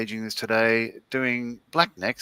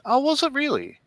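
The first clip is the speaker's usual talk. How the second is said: high-pitched, intrigued reply; high questioning reaction that drops at the end; surprised interest; 12 semitones higher than usual. Real, not acted. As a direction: lift the voice right up in surprise. Reacts to trivia from chat.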